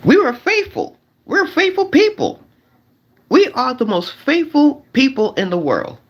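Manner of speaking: falsetto, mock-innocent claim of faithfulness; mock high faithful voice. To the fellas, mocking men who swear they're faithful, in a high mock-innocent voice.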